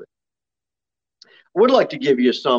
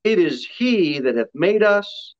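slow, drawn-out psalm reading; higher, measured proclamation; scripture reading; 5 semitones higher than usual. Real, not acted. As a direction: read it out to the congregation, stretching the key words. Reads the psalm aloud.

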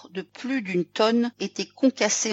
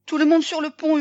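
captain's shout, high and loud; yelled order; 8 semitones higher, 7 dB louder than usual. A narrator's reading. Captain to crew on the icy ship, yelling. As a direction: holler it to the whole crew. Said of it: "hurla-t-il".

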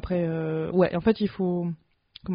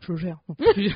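normal talking voice

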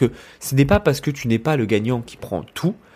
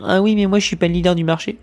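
whiny complainer; 6 semitones higher than usual. Retold or imitated. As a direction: play the self-pitying entrepreneur making excuses. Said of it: "se plaindre et dire". (une plainte légèrement jouée, un peu plus aiguë)

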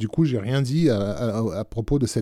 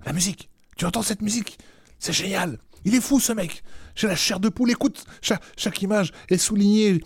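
much higher, raving with excitement; enthousiasme délirant; 8 semitones higher than usual. Retold or imitated. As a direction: gush like a film fan losing his mind. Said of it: "en répétant à ma femme". (s'extasier d'une voix aiguë, emballé)

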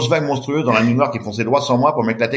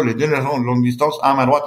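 usual speaking voice

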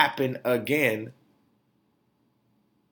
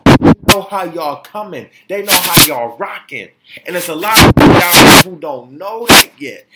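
loud, forceful ranting; 4 semitones higher, 14 dB louder than usual. Real, not acted. Ranting at Texas officials, loud and forceful. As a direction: shout it out at the officials.

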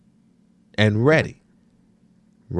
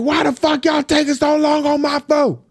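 shrill-sounding raised imitation of an angry customer, much higher; high-pitched mock outburst; 3 dB louder than usual. Comic imitating angry customers yelling behind the glass, high mock rant. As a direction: squeal out the complaint way up high. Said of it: "seen some people go off".

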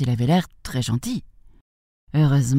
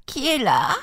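put-on high-pitched old lady calling from bed; high granny voice asking who's there; old grandmother; 10 semitones higher than usual. A narrator's reading. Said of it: "demanda la vieille dame du fond de son lit".

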